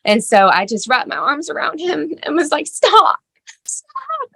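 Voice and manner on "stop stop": desperate plea. Retold or imitated. Hugging son who hurts himself.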